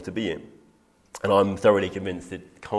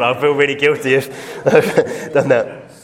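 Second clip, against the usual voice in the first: laughs midway through a guilty admission, slower and louder; laughing; 4 semitones higher, 11 dB louder, slower than usual. Real, not acted. Preacher owning up to the congregation, laughing. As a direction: chuckle out a sheepish confession. Confessing skipping home church.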